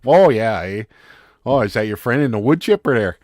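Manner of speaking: lightly voiced Minnesota accent with stretched oh and eh; easy Minnesota accent, Fargo style. Shown as a demonstration. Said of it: "I can do a Minnesota accent from things that I've heard, like from Fargo".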